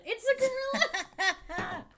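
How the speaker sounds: loud surprised exclamation; excited shout. Real, not acted. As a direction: blurt it out loud. Excited gorilla reveal.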